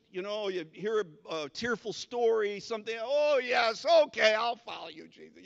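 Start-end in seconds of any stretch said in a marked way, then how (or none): 3.0-5.4 gushing, swept-up promise; a touch of hammed-up emotional pledge, fading away; mock emotional. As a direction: blurt it out carried away. Emotional convert after tearful story.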